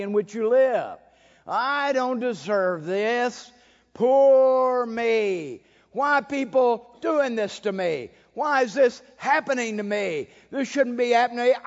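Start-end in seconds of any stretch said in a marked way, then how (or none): 1.5-11.6 higher, louder whining poor-me voice; self-pitying whiner. Acted. Preacher mocking the self-pitying churchgoer, whining. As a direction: whine like a self-made victim. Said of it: "victimology of the society".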